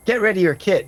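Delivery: high-pitched command to the camp; woman giving orders. Acted. Woman telling her people to pack up, high commanding voice. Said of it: "you hear her give an order".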